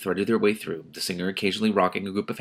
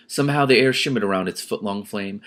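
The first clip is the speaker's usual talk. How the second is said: ordinary delivery, nothing marked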